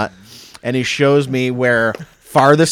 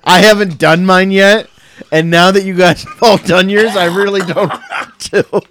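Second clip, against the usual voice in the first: laughing; 5 semitones higher, 4 dB louder than usual. Real, not acted. Laughing at the pickle tasting.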